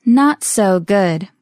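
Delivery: very slow, word-by-word reply; slowly. Shown as a demonstration. Answering Mike in a practice dialogue, slowly. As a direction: stretch out every word for learners. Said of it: "one more time slowly".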